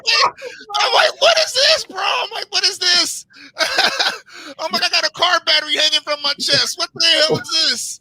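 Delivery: retelling waking up with a catheter, shouting in panic; panicked shout. Retold or imitated. Panicked cry of what is this. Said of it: "half crying and flipping out".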